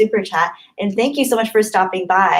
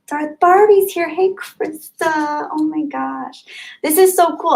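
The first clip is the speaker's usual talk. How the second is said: thrilled greeting; 8 semitones higher than usual. Real, not acted. Streamer greeting Krista as she arrives, thrilled. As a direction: squeal a hello to a friend.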